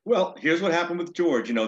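ordinary talk

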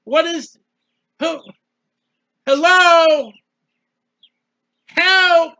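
loud, high desperate plea; crying out to God. Acted. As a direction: shout up to heaven for an answer, drawing out the single words.

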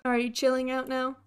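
ordinary talk, nothing marked

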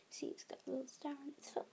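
soft, quick secretive whisper. Real, not acted. Showing sister's toy's secret detail.

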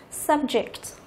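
"subject" said slowly, stressed on sub; first-syllable stress. Shown as a demonstration. Teacher reads out option A for students, slow "subject" stressed on sub. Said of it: "trọng âm rơi vào âm thứ nhất".

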